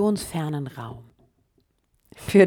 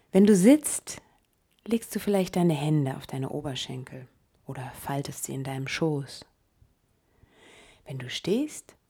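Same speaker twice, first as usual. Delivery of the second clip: guided meditation. Acted. Leads a meditation.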